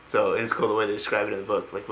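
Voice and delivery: ordinary delivery, nothing marked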